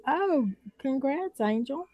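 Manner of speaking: high, drawn-out congrats; congratulating. Real, not acted. Congratulating a friend on a milestone.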